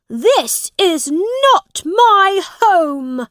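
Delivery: high, loud, slow outraged prince; crying out. A narrator's reading. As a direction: cry out in high-pitched protest. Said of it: "he cried out".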